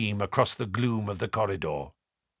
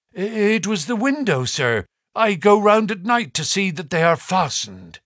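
nervous, hurried excuse, a little higher; mild agitation; 6 semitones higher than usual. A narrator's reading. Butler explains to Sir Henry, mildly agitated. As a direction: fumble for an excuse, lightly shaken. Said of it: "His agitation was so great".

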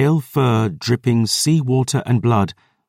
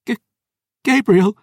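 stunned cry; 11 semitones higher, 3 dB louder than usual. Acted. Dior sees Gabriel alive.

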